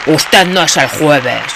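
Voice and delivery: loud, slow booming heckle. A narrator's reading. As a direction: boom it out. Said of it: "con un vozarrón". (vozarrón atronador)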